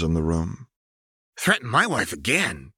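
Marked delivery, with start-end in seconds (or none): 1.4-2.7 furious threat. Acted. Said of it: "stared him down with wide, furious eyes".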